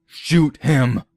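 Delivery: high insistent command; high barked order; harsh order. Acted. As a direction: demand the shot. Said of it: "Nelson said".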